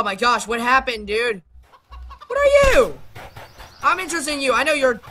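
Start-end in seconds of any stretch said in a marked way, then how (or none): 2.3-3.0 high, loud, swooping question; startled question. Pretend. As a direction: blurt it out in high-pitched astonishment. Startled by a strange creature.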